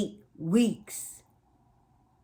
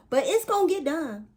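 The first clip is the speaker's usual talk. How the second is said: high, loud, determined burst; determined declaration; 10 semitones higher, 5 dB louder than usual. Acted. Emphatic self pep talk.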